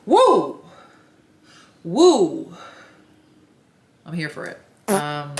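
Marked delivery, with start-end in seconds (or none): 0.1-2.5 loud, high-flung cheering whoop; excited whoop. Real, not acted. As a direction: whoop it out in approval. Cheers the short man's confidence.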